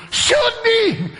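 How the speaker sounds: very high, loud comic shriek; imitated lady. Preacher mimics lady jumping up, shrieked. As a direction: squeal it out as an over-eager lady. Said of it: "some lady jumps up and goes".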